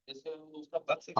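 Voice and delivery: choppy audio cutting out; bad connection. Real, not acted. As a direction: voice breaking up on a bad line. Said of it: "आपकी आवाज cut cut के आ रही है".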